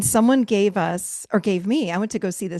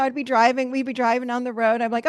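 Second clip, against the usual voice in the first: normal talking voice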